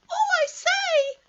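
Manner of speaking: very high, loud, slow little squeal; a small piglet jumping about in excitement; excited Piglet squeak. Acted. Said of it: "making noises".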